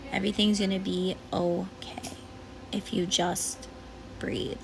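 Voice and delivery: hushed and calm, almost whispered; soothing whisper to a worried parent; soft whisper. Real, not acted. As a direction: whisper it gently, reassuring. Calming a scared parent.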